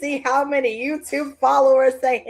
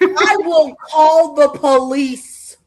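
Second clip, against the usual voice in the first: mock threat; 7 dB louder, much slower than usual. Threat over soda.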